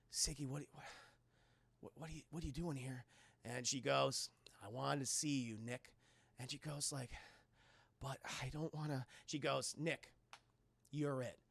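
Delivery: low, soft acted dialogue; hushed tender exchange. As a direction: murmur it haltingly to an old flame. Retold tense reunion of old lovers.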